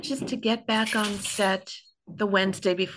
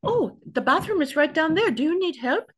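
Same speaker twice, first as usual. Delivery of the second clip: bright eager helper, pitched up; cheerful helpful voice; 8 semitones higher, 5 dB louder than usual. Retold or imitated. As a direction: play the overly helpful crew guy. Mimicking the helpful cameraman.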